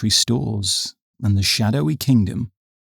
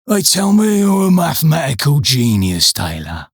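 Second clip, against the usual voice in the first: lazy; 4 semitones higher than usual. A narrator's reading. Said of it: "said Harris lazily".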